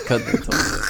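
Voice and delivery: breathy unvoiced whisper. Shown as a demonstration. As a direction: whisper it like a secret. Repeated line whispered.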